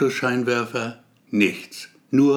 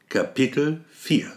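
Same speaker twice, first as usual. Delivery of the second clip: usual speaking voice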